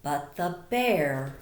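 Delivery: very slow, drawn-out refrain. A narrator's reading. Teacher reading the sleepy refrain to the class, very slow. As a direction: stretch the refrain out to lull. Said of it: "refrain read slowly".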